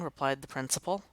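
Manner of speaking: ordinary delivery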